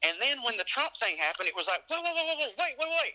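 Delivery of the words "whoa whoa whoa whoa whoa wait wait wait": much higher, imitating his own startled alarm; alarmed disbelief. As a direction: replay the moment it hit you: whoa, wait. Retold shock at Trump's win.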